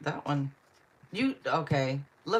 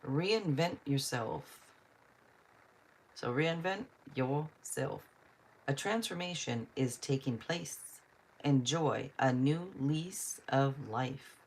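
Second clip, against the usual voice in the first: slow, evenly spaced read-out; deliberate card reading; slower than usual. Acted. Card reader telling a viewer to reinvent herself, deliberate. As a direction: read it out slowly, phrase by phrase.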